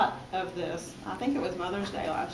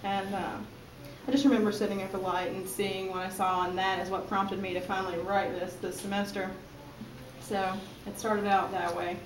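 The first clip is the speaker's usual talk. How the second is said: ordinary talk, nothing marked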